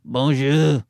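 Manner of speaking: put-on low, smoky French-accented kids' entertainer; thick French accent, smoker. French Blippi teaching kids rudeness, low thick French accent. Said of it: "Sound like you're smoking a pack of cigarettes".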